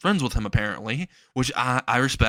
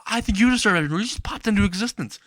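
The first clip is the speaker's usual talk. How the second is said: higher, sharp angry comeback; angry retort; 8 semitones higher, faster than usual. Acted. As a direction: throw it back at him, irritated. Bakugo snaps back at Izuku.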